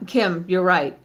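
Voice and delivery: usual speaking voice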